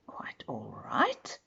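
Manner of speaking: slow, unvoiced, wondering whisper; whispered to himself. A narrator's reading. Mister Good, alone, repeats the stranger's words in an astonished whisper. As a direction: breathe it to yourself in disbelief.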